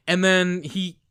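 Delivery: normal talking voice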